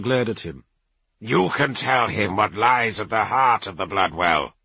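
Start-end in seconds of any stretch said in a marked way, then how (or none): 1.2-4.5 stern Rune Priest. Acted. Kavar urging.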